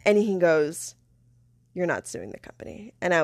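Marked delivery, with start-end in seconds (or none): 1.7-2.9 softly voiced, disbelieving coworker's reply; hushed reply. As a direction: drop to a near-murmur. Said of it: "whispered it".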